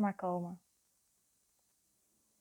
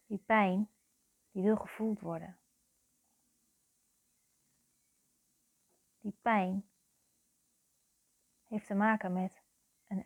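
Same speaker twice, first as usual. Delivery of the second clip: guided meditation. Real, not acted. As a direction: guide the listener into their feelings, phrase by phrase. (langzame, begeleide meditatie met lange pauzes)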